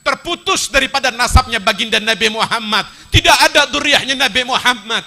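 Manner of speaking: forceful mock declaration by opponents; loud denouncing proclamation. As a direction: hurl the claim out to the crowd. Voicing hostile troops' taunting claim.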